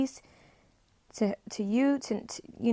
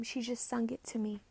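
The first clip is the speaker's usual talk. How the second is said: whispered, breath only; awed whisper; 11 dB quieter than usual. Real, not acted. Psychic in awe of what she heard, whispering. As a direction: whisper it as if it barely happened.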